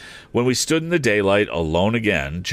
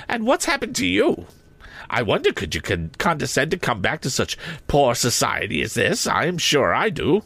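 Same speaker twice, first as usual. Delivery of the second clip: higher, sharp mocking voice; sarcastic sister; 6 semitones higher than usual. A narrator's reading. Sister scolding Joe and Pip, sarcastic. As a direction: needle them with sarcasm.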